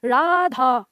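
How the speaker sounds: राधा with the last आ stretched long, like calling out; drawn-out call. Shown as a demonstration. Teacher showing the class how राधा is called out, long final आ. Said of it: "खींच के पढ़ना होगा".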